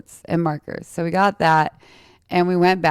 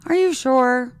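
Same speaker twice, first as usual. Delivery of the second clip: high, mocking, concerned-adult voice; imitating naysayers who talk down sweetly; doubtful adviser; 6 semitones higher than usual. People discouraging her dreams, in a high mocking voice. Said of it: "someone would be like".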